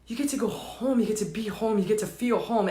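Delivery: very soft, low assurance; hushed, intimate comfort; tender promise. Real, not acted. Soothing promise to listeners.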